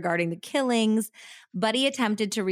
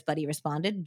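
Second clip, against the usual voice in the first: ordinary talk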